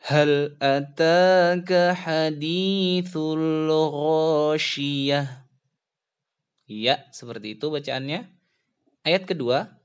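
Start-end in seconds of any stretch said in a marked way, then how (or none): 0.1-5.2 chanted هل أتاك حديث الغاشية with tajwid; Quran recitation. Shown as a demonstration. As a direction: recite it as an example for the pupils. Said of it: "Bapak contohkan".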